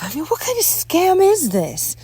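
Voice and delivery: high, loud indignant question; loud, incredulous accusation; outraged. Acted. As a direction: demand it in outrage. Outraged at brochure.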